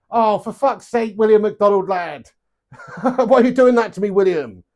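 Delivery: half-amused, drawn-out exasperated complaint; laughing exasperation. Real, not acted. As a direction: protest loudly at being guilt-tripped. Laughing protest at being guilt-tripped.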